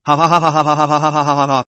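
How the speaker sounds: rapid teasing ha ha; fast mocking laugh. A narrator's reading. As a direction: cackle fast and gleeful.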